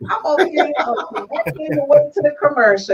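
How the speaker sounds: laughing through the words; words broken up with real laughter; overwhelmed, laughing it out. Real, not acted. Host overcome, laughing at couple.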